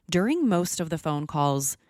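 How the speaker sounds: ordinary talk, nothing marked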